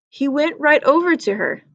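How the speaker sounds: usual speaking voice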